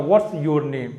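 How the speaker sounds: ordinary talk, nothing marked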